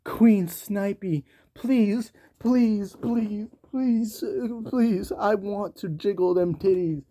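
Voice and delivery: high, slow pleading mock; begging simp. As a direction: whimper please like a desperate fan. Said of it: "being like".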